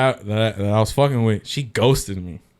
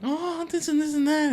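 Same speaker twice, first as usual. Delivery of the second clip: mock girl chatter. Imitating the girls' chatter.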